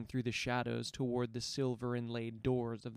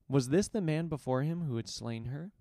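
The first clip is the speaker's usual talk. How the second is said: plain reading voice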